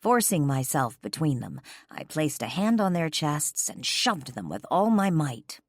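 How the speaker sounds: plain reading voice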